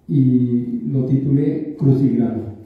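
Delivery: usual speaking voice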